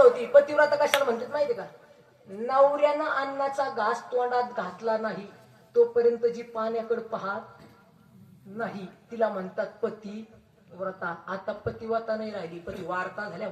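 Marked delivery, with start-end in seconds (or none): none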